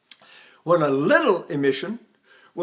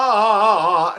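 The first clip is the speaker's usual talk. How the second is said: louder ah with the breath attached; attached chest ah; 3 semitones higher, 7 dB louder than usual. Shown as a demonstration. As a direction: anchor the ah on the diaphragm. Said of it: "and it doesn't crack".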